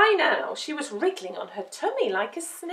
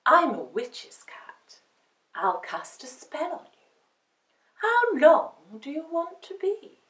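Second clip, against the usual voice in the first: mysterious cat; 4 semitones higher, 3 dB louder than usual. Acted.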